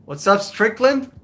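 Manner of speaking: usual speaking voice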